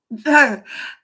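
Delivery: laughing out loud at the joke; real laughter. Real, not acted. Laughing at Cheryl Caramel, real laughter. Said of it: "I couldn't stop laughing".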